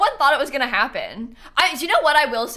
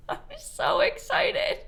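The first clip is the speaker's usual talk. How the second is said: very high, slow excited squeak; giddy excitement; slower than usual. Real, not acted. Streamer who finally found Wart, giddy high squeal. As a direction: squeal it slowly in a tiny high voice.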